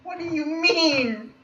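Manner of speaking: very high, slow, loud demand; exasperated wail. Real, not acted. Demanding an explanation of the fan fiction remark, high wail. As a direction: stretch it into a high-pitched wail.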